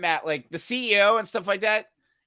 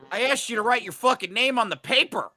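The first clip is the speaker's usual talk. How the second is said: louder, higher, fed-up teacher snapping back; exasperated retort; 5 semitones higher, 5 dB louder than usual. Retold or imitated. Teacher retelling what he told a stressed student, exasperated. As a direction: let the frustration out on the fucking.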